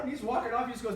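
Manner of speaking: normal talking voice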